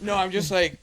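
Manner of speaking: usual speaking voice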